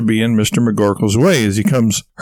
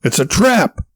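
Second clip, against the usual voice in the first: mild yell; slower than usual. A narrator's reading. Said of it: "Warble yells out".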